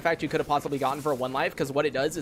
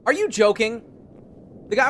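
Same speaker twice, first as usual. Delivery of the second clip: incredulous outburst; 6 semitones higher, 6 dB louder than usual. Real, not acted. Reacts to bad news.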